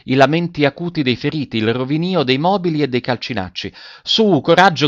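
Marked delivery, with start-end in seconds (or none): 4.0-4.9 higher-pitched urging cry; urgent shout. A narrator's reading. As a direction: cry out to spur on the distant runner.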